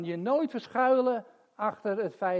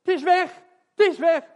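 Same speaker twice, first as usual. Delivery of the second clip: high, loud joyful shout; excited shout; 14 semitones higher, 11 dB louder than usual. Retold or imitated. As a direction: shout it in disbelief and joy. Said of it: "wat zei Jos?". (luide verbaasde vreugdekreet)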